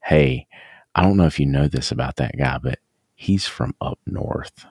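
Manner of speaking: low, hushed warning, fading to unvoiced; confiding client. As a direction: lean in and gossip. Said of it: "client pull me aside and say".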